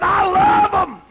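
high, loud eager shout; mock shouted delight; quoted exclamation. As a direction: belt it out like a happy guest. Greens-loving man quoted loudly.

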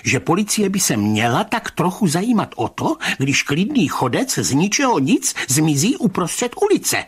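high, swooping, indignant reproach; sharp indignation. A narrator's reading. Rybka reproaching the police, sharp and indignant in high voice. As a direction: declare it sharply, pitch leaping on key words. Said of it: "prohlásil ostře".

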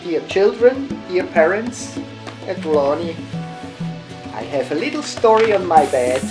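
ordinary delivery

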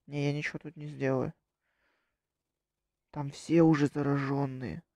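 normal talking voice

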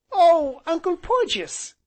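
put-on very high, loud child's cry; panting small boy. A narrator's reading. Small boy galloping up to his uncle, high excited cry. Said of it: "he panted as he came up".

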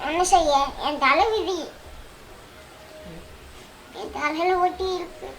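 soft, resigned lament trailing away; tearful. Acted. Wife bewailing her fate as husband leaves, tearful. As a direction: lament your fate, sinking into quiet tears. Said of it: "ஏன்டி அழுதுகிட்டே சொல்ற?".

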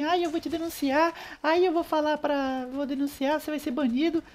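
mock a crybaby threatening to report; whiny complainer. Player mocking a complainer who threatened to report him, high whiny voice. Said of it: "O cara ficou desse jeito".

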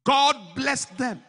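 shouting the blessing to the congregation; preaching at full force. Acted. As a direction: drive it home like a revival preacher.

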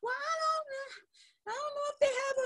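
high, slow, drawn-out mock customer voice; hesitant customer. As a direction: play the unsure buyer stalling on the call. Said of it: "As soon as they say".

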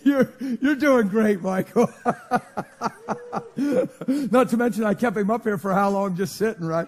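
loud words pushed through laughing; real laughter. Real, not acted. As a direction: talk while cracking up, stretching the words. Laughing at Michael beside him.

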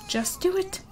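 high, soft quick nudge; just do it, said up high and light; high urging. Real, not acted. Urging her Sim to change the diaper, high soft nudge.

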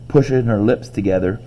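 ordinary delivery

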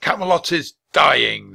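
big projected declaration; booming. A narrator's reading. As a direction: proclaim it gravely across a hall. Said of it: "the Christmas knight boomed".

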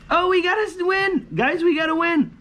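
high, loud shout of joy; excited yelling. Real, not acted. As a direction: yell it out in delight. Sudden lottery win.

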